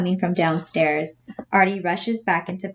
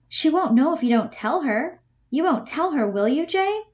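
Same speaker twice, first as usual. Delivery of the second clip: higher, coaxing Bella voice; pleading Bella, higher; 9 semitones higher than usual. Acted. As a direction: coax him, pitched up like Bella. Teacher voices Bella in play reading.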